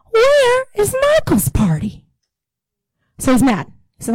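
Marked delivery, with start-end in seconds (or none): 0.1-2.1 higher, slow outraged question; indignant. Acted. Preacher voices the older brother's grievance, indignant. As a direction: demand it with mock outrage.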